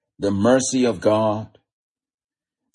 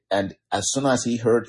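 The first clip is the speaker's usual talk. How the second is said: ordinary talk, nothing marked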